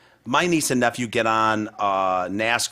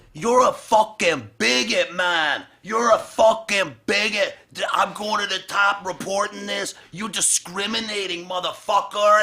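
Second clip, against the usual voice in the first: imitating angry mom; 8 semitones higher than usual. Retelling a mom screaming at a teen ride worker, high mock rage. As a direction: ham up a furious mom calling someone a bigot. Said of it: "she's going".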